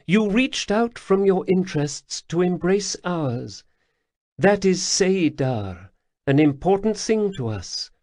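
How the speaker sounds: high, purring alien lilt; Khajiit matriarch. Acted. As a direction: speak as a grateful cat-woman elder. Lesper explains her debt.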